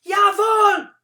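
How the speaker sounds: high, loud shout; boy shouting yes to the soldier; called out. Acted. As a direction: snap it out like a soldier. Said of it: "I called out".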